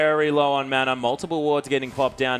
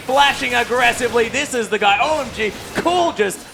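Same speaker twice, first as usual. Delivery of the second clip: rising hype as the play lands; excited play-by-play; 8 semitones higher, 4 dB louder than usual. Real, not acted. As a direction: call the big moment with a jump in pitch. Big play caught live.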